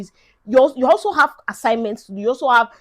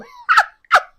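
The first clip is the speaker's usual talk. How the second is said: high laugh; 9 dB louder than usual. Real, not acted. Laughing at own awkward accent.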